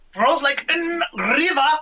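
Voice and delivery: a hint of the high Einstein voice. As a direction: slip briefly back into Einstein. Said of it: "like Einstein said".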